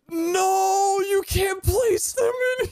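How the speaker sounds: very high, drawn-out wail of protest; dismayed wail. Real, not acted. Player finding items can't be placed, dismayed wail.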